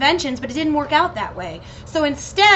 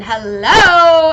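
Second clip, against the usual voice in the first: loud excited shout; 3 semitones higher, 9 dB louder than usual. Real, not acted. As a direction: call it out with delight. Excited about Lando Calrissian.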